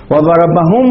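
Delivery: "وضربهم" stopped on a vowelless m; pausal mim. Shown as a demonstration. Teacher showing the pause form, "وضربهم" ending in -hum. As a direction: close "وضربهم" on the m, no final u. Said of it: "يقف على الميم بالإسكان".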